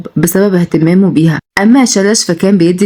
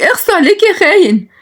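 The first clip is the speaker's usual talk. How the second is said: very high, hurt and scolding cry; 13 semitones higher than usual. Acted. Nagia scolding Shalash as a traitor, very high and hurt. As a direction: hurl it at him as a betrayal. Jealous woman scolding husband figure.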